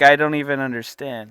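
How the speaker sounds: ordinary talk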